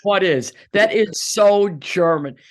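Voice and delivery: high, loud and slow, delighted; loud amused exclamation. Real, not acted. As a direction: belt it out with delighted disbelief. Laughing exclamation about husband.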